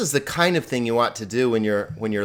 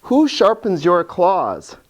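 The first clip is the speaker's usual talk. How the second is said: slow example sentence; 6 semitones higher, slower than usual. Shown as a demonstration. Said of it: "I might also say something like".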